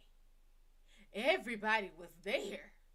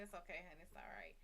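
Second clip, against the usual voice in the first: soft reassuring; 5 semitones lower, 14 dB quieter, faster than usual. Retold or imitated. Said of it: "I said".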